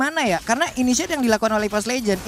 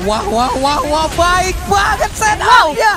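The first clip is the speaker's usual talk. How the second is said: excited; 4 semitones higher, 5 dB louder, much slower than usual. Real, not acted. Caster hyped by team setup.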